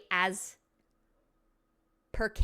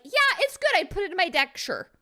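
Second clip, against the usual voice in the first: casual shrug; 9 semitones higher, 5 dB louder than usual. Retold or imitated. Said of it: "three means, like".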